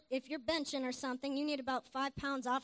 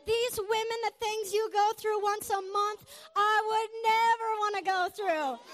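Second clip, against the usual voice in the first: loud, high acted outburst; hammed-up emotional tribute; overwrought declaration; 8 semitones higher, 8 dB louder than usual. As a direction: proclaim it as a man overwhelmed. Acts out the overwrought husband's admiration.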